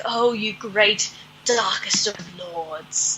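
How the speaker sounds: slow, fawning devotion; fawning groupie. Pretend. Daughter flattering the dark lord like a slow fawning groupie. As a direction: grovel to the dark lord like a starstruck fan. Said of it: "sounding borderline groupie here".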